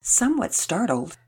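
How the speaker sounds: ordinary delivery, nothing marked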